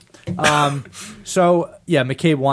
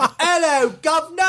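mock Cockney accent; 13 semitones higher than usual. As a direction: overdo a fake Cockney greeting.

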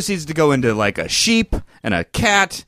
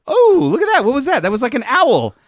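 much higher and louder gasp of wonder; mock delight; 10 semitones higher, 4 dB louder than usual. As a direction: parents gush at the bird lady, way up high. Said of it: "my wife and I both go".

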